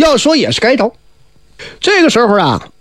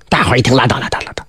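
hushed whisper of the crowd giving up; whispered dismissal; 7 semitones lower, 3 dB quieter, faster than usual. Acted. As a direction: whisper it under the breath, waving it off. Crowd waves the matter off.

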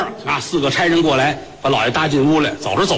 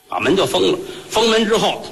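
ordinary delivery

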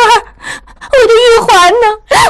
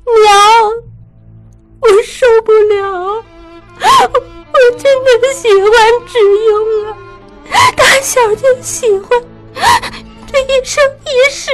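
heartbroken sobbing. Acted. Daughter sobs heartbroken confession to her mother. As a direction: pour out the grief, breaking. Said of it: "痛入骨髓地说".

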